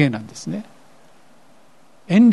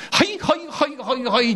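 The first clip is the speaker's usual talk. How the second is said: eager grandma reply; 5 semitones higher, 3 dB quieter than usual. Retold or imitated. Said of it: "って全ての用をやめて".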